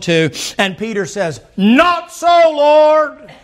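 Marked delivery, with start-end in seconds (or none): 1.6-3.3 Peter's protest. Acted.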